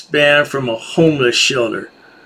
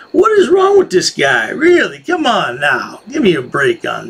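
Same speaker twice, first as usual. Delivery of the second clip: incredulous scoff, pitched high; exasperated disbelief; 5 semitones higher than usual. Real, not acted. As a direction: pitch jumps much higher in mock disbelief. Commentator mocking the auditor.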